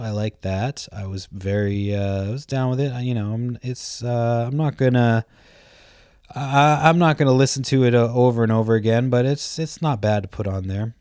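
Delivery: usual speaking voice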